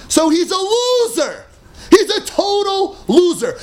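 mock contempt for the loser; mocking scorn. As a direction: sneer it out like a playground taunt. Mocking the failed rationalist.

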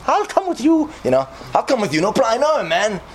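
an ancient rabbi brushing the danger aside, voice way up; eager old rabbi. Acted. Ancient rabbi promises the condemned man he'll come, high and eager.

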